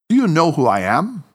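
"Do you know who I am?" rising on you, measured and even; a level, controlled challenge; understated professional demand; composed professional question. A narrator's reading. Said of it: "his most neutral professional tone".

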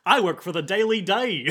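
bad New Yorker voice; New York accent. Continues the accent.

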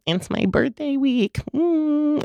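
high, slow, sing-song mock of a birthday-week woman; mocking birthday girl. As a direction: parody the women she hates. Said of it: "one of those women that I hate that are like".

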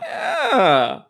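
high drawn-out mock yeah; teasing high-pitched imitation; imitated battle cry. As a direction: mimic a friend's drawn-out cry. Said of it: "that's the sound".

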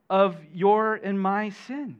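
ordinary delivery

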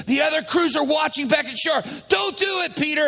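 high-pitched mock protest; urgent pleading. Crewmates afraid of being watched, pleading. Crew begs Peter not to.

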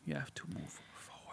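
very soft whispered aside; hushed, unvoiced murmur. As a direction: breathe it out in a whisper. Quiet aside in English.

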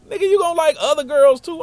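high, loud imitation of scolding; hammed-up incredulous squawk; exasperated friend. Friend setting the heartbroken boy straight, loud exasperation.

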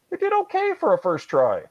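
deadpan the Soviets "did okay for a first try"; wry understatement. Acted.

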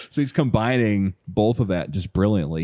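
ordinary talk

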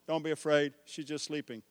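soft, quiet calm; Jesus calming the grieving household; gentle reassurance. Retold or imitated.

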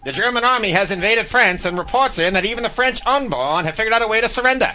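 put-on high, loud old-time newsreel announcer; old newscaster. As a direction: boom it out like a wartime newsreel. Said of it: "old newscasters".

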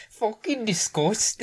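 high, disgusted Scottish mum voice. Retold or imitated. Echoing the mum from the video, high disgusted Scottish. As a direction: spit it out like the outraged mum.

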